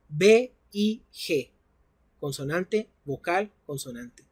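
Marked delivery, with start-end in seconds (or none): 0.1-1.5 letters spelled out slowly, one by one; B I G spelled out slowly and clearly; slow spelling. Shown as a demonstration. Teacher spells the word letter by letter.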